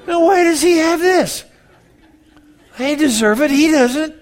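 high, loud indignant whine; jealous complaint. Pretend. Pastor voicing his envy of a young pastor, high loud whine. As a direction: protest like a spoiled child.

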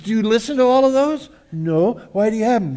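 high, loud amazed question; incredulous questioning. Retold or imitated. Preacher asking a kid about thousands of songs, high amazed. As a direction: marvel at the number.

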